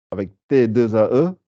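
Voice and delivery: ordinary delivery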